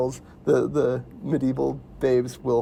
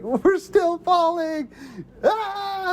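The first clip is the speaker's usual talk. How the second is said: high, slow, hammed-up wail of panic; mock falling scream; much slower than usual. Retold hero falling forever, mock panicked high wail. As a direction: stretch it out high like you're still falling. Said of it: "And then he's like".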